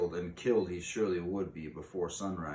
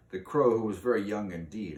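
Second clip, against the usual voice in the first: usual speaking voice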